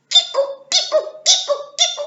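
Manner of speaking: very high monkey chatter; yelling monkeys. Acted. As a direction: screech like scared monkeys. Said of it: "yelled the monkeys".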